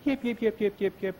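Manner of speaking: quick high-pitched coyote yips; a string of short, high yelps; coyote yipping. Acted. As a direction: yip like a coyote at night. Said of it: "listen to coyotes at night".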